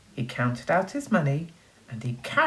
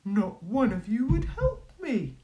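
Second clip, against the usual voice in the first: a lightly voiced hurt wolf, higher; reproachful wolf; 8 semitones higher than usual. The wolf reproaches the neighbours with a hint of hurt. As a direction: tell them off with hurt in it.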